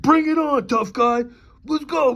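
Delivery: high, loud macho challenge; mock tough-guy taunt. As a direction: sneer it like a cocky tough guy.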